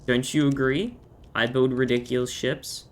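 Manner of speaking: usual speaking voice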